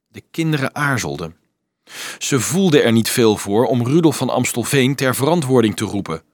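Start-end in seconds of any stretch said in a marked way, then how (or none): none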